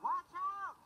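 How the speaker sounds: high, loud alarm call; warning shout. Acted. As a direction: yell a warning. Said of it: "shouted Alfie".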